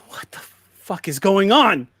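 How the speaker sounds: slow, breath-filled outburst climbing high and loud; acted panic, starting hushed and bursting out; whispered disbelief swelling into alarm; verge of panic. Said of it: "he's on the verge of panic".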